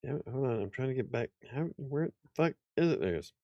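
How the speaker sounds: normal talking voice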